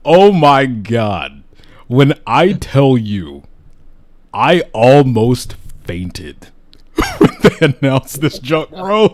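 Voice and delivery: slow, loud, laughing gush; overjoyed, laughing through the words; excited. Real, not acted. Gushing over game announcement.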